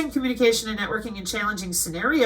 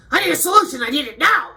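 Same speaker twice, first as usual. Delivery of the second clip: higher, louder whiny demand; mock impatient demand; 5 semitones higher, 5 dB louder, faster than usual. Mocking impatient men demanding a fix, higher whine. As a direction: parody a pushy guy wanting it now.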